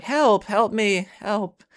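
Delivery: high, slow, drawn-out cry for help; mock scream for help. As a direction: wail help like a scared stranger. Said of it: "screaming out to us".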